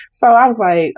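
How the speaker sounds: ordinary delivery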